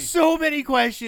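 high, loud incredulous shout; exasperated outburst. Real, not acted. Host baffled that detective lets them go, exasperated shout. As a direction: cry out in comic exasperation. Repeated outburst at plot hole.

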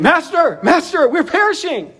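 urgent high-pitched cry for help; panicked plea. Acted. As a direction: read it as a desperate plea, pitch leaping up. Reading disciples' panicked plea to Jesus.

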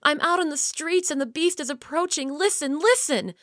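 put-on scared child begging through a door; frightened plea. A narrator's reading. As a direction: make them hear the danger coming. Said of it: "Sam cried".

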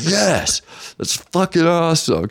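high, jubilant agreement; thrilled. Retold or imitated. Telling how he cheered the chess player's reference, thrilled. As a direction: act out delighted recognition. Retells his thrilled answer.

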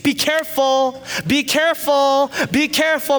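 high, loud panicky mock yell; mom yelling. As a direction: imitate a frantic backseat mom. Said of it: "My mom's just yelling at me".

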